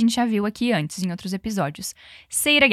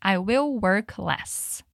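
"I will work less" spoken very slowly and evenly; slow model sentence; 3 semitones lower, much slower than usual. Shown as a demonstration. Teacher models "I will work less" for students, very slow. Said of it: "repete comigo".

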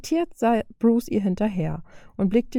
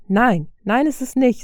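loud, higher, rising panic on nicht; a bit hysterical; 5 semitones higher, 5 dB louder than usual. Acted. Tony tells Bruce it's not fine, a touch hysterical. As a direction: protest too shrilly that it's not fine. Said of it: "etwas hysterischer".

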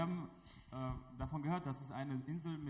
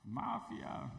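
put-on drunken yelling; 8 semitones higher than usual. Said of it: "und schreit".

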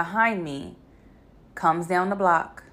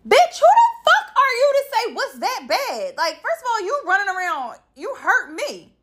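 indignant rant; 13 semitones higher than usual. Retold or imitated. Tells off the driver who hit her, in high, laughing indignation. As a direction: tell off the other driver in disbelief. Angry outburst at the other driver.

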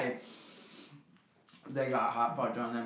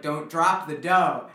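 slow warning; 4 dB louder, much slower than usual. Retold or imitated. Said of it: "you were like".

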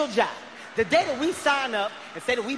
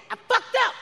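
high squeal; 13 semitones higher, 3 dB louder than usual. Acted. Mock confession squeal.

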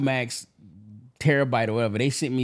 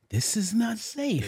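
mock news anchor; 6 semitones higher, 7 dB quieter, slower than usual. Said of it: "the news was like".

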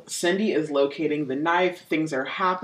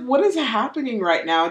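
higher, incredulous exclamation; baffled disbelief; 7 semitones higher, 4 dB louder than usual. Acted. Host reacting to the absurd investigation story, incredulous.

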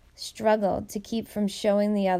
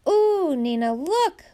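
high, louder excited squeal; 8 semitones higher, 4 dB louder, slower than usual. A narrator's reading. Little girl squealing to Nina over the bread. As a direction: squeal with glee. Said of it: "Elia squealed".

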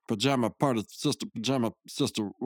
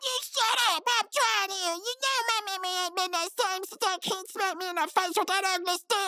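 much higher put-on cartoon voice; cartoon comeback voice. Snapping back at the friend's insult in a squeaky voice.

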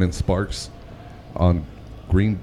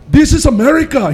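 much higher, louder mock Indian accent; 5 dB louder than usual. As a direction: shout it in a put-on Indian voice. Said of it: "And then he's like".

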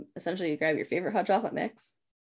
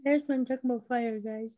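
put-on childish fire lover; 5 semitones higher than usual. Teasing, playing a fire lover.

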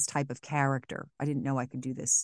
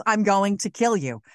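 Frackney's threat; 4 semitones higher, 6 dB louder than usual. Retold or imitated. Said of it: "her level is".

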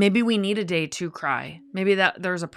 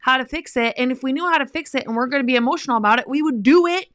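louder, higher mock exasperation; exasperated girlfriend; 6 semitones higher, 5 dB louder than usual. Woman imitates a fed-up girlfriend, rising to loud high pitch. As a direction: play a friend who's fed up, climbing higher. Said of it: "they're not being like".

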